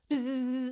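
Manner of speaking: long, drawn-out buzz; imitated buzzer. Comic as chip going off on the street, buzzing. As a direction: buzz like an alert device.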